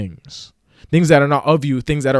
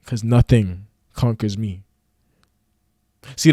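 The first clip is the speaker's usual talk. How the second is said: ordinary talk, nothing marked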